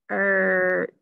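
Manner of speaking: "search" drawn out as s... er... ch; stretched sound by sound. Shown as a demonstration. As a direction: hold each of the three sounds in "search". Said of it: "Stretch that out".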